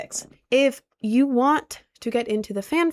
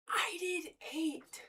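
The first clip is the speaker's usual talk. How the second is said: whispered, drawn out and proud; slow whispered boast; 4 semitones higher, 14 dB quieter, slower than usual. Real, not acted. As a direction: breathe it like a secret boast. Whispers the same claim.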